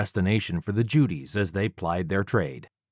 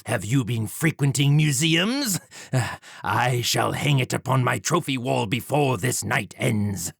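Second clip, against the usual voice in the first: higher, scornful gentleman villain; mocking Jack; 4 semitones higher than usual. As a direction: ridicule the old sword. Villain Jack voiced in story.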